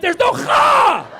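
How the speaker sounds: high, loud, slow guttural Kha; mock harsh Kha. Teacher correcting him with an overdone Kha, loud and slow. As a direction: drag out the rasping Kha. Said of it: "She goes".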